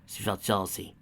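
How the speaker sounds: ordinary delivery, nothing marked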